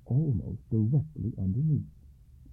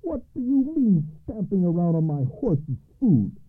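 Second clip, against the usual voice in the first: high, rising indignant scolding; angry tirade; 3 dB louder than usual. A narrator's reading. Farmer Andre scolds Alba for stamping on the hay, indignant. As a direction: scold him for trampling the hay. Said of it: "he shouted".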